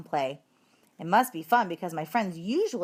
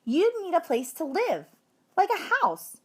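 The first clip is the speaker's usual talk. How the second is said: put-on girl's voice; 10 semitones higher than usual. A narrator's reading. Tabitha answers the teacher.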